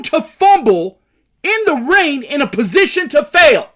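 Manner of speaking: loud, high, angry shouting; furious rant. Real, not acted. Fan ranting about his team's coach, furious shout. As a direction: tear into the team's coach at full volume. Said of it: "Do I sound fucking pissed?".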